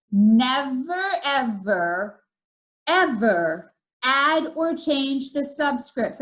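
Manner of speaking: slow repeated warning, each word set apart; deliberate and spaced out, firm opening word; very slow, emphatic. Shown as a demonstration. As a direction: drill the rule in, stretching every word. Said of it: "I'm gonna say it again".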